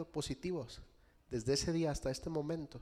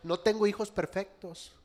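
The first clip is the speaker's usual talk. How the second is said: ordinary delivery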